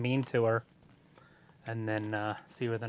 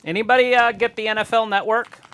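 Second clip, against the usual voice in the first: louder call, pitched higher; calling out to chat; 6 semitones higher, 8 dB louder than usual. Real, not acted. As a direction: shout the question out to viewers. Calls out to the chat.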